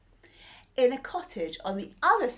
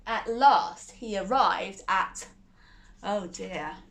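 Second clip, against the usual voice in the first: ordinary delivery, nothing marked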